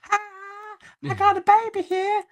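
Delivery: very high, airy put-on woman's voice; wife strolling toward the lion with her baby, very high chirpy voice; clueless cheery wife. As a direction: chirp it way up high, blissfully unaware. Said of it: "She's like".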